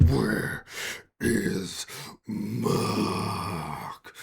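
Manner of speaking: slow monster voice, word by word; menacing creature. Acted. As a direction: drag each word out like a drooling beast. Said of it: "and goes".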